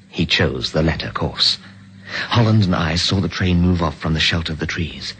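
even, measured storyteller's voice; story narration. Acted. Narrator recounting the escape from the train, in a steady storytelling voice. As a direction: tell the escape calmly, looking back on it.

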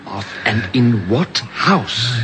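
desperate urging at a dying man; urgent pressing. Acted. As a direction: push him for the answer before it's too late.

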